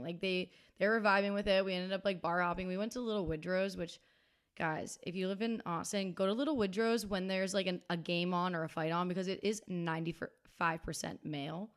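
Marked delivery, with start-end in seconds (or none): none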